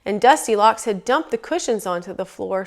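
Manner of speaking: plain reading voice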